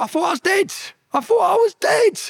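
strained high cry, stretched out on the repeat; retold screaming. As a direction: re-enact screaming, voice climbing very high. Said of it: "And then I was screaming".

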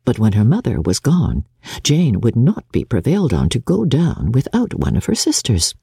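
normal talking voice

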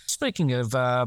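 ordinary talk, nothing marked

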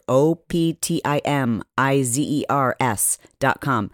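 O P T I M I Z E R S dot com, slow, letter by letter; spelling it out. Shown as a demonstration. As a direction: spell O P T I M I Z E R S out, slowly. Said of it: "I'm gonna spell it for you".

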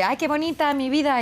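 doting mom at normal volume; sweet and cute. Shown as a demonstration. Mother showing how she really spoke to daughter, sweet and restrained. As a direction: coo it nicely, keeping it contained. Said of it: "estaba así muy mona".

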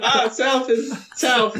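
slow, high, laughing pronouncement; mock sage. Players joking about south, slow mock proverb through laughter. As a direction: intone it like ancient wisdom.

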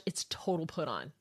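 hushed aside, voice dropped to a whisper. Real, not acted. Host whispering to his listeners about the put-on accent. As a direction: let the listeners in on a secret.

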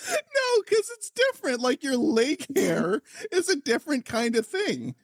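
high, squeaky laughter-strained voice; helpless giggly high pitch; real laughing through words. Real, not acted. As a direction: talk while recovering from a laughing fit. Still recovering from long laughing fit.

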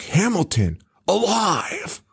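rising, high-pitched mock disbelief; mock astonishment. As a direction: imitate a dramatic shocked reveal. Retold shock at seeing villain.

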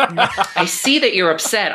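milk the moment slowly. Retold or imitated. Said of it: "Ru would go".

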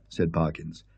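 ordinary delivery, nothing marked

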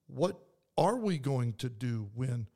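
usual speaking voice

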